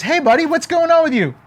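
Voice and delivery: high, friendly bird voice; a perky sparrow calling hello; cheery sparrow. Acted. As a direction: greet him like a chirpy little bird.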